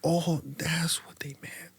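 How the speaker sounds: hushed whisper of sudden understanding; whispered realisation. Retold or imitated. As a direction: breathe it out as a private aha moment. Sudden realisation, inner thought.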